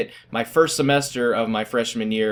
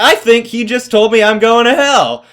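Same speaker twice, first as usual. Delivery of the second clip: very high, loud mock-horrified outburst; shocked exclamation; 10 semitones higher, 9 dB louder than usual. Student realizing what teacher told him, loud mock horror. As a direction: cry it out in hammed-up disbelief. Acting out his shocked reaction.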